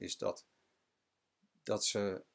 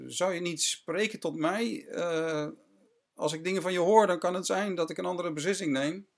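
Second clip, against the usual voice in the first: higher, insistent Pilate pressing for an answer; 8 semitones higher, 4 dB louder than usual. Retold or imitated. Pilate pressing the silent Jesus to speak, higher and insistent. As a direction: lean on him, higher, like a judge demanding a reply. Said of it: "zegt Pilatus".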